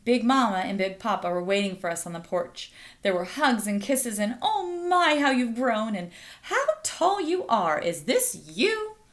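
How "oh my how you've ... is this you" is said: high, gushing relative's exclamations; delighted surprise. Acted. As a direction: fuss over how tall they've grown. Relatives greet grown children.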